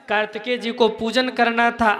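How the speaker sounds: ordinary talk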